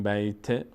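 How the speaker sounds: بیت ending in a short e, "bayte"; kasra on final t. Shown as a demonstration. Teacher explains the mistake, kasra on final t. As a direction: add an e after the t of بیت. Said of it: "خب کسره بهش دادیم".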